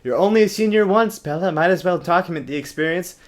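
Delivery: high, loud chirpy Alice voice; bubbly cheerleader sell; high bouncy enthusiast; perky Alice. Pretend. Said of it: "Alice thinks they're a great idea".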